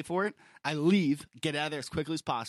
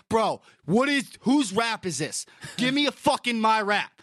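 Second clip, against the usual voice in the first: louder, higher, drawn-out indignant demand; angry confrontation; 5 semitones higher, 7 dB louder than usual. Retold or imitated. Customer confronting deli guy over wrong wrap, acted-out angry outburst. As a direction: act out storming up furious to the counter. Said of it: "I was so angry".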